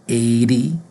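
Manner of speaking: "eighty" drawn out as "eidy", rising. Shown as a demonstration. Teacher modelling eighty, D sound. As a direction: put a light D in "eighty". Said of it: "we pronounce it with, with a D".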